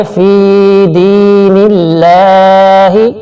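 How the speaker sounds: slow chanted tajweed recitation; Quran recitation. Shown as a demonstration. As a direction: recite it slowly with the long vowels drawn out. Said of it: "تھوڑا سا کھینچ کے".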